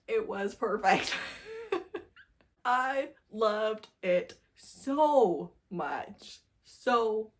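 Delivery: slow, drawn-out gushing with a laugh; swooning over a favorite book. Real, not acted. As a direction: savour every word, she loved it.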